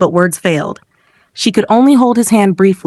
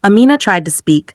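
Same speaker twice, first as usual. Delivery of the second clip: ordinary delivery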